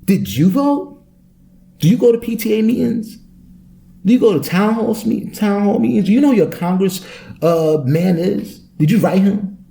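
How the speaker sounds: high, insistent quizzing voice; mock scolding preacher. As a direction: grill them like a lecturing know-it-all. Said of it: "And I'm just like".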